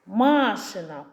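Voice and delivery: «Машина» with long stressed first а, MA-shyna; stress on first syllable. Shown as a demonstration. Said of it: "Пошукаємо наголос у слові". (наголос на перше «а» в слові «Машина»)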